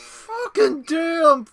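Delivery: high, drawn-out swearing cry; frustrated cursing. Real, not acted. Player cursing the game, frustrated cry. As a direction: stretch the curse out in exasperation.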